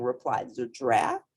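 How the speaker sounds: ordinary delivery, nothing marked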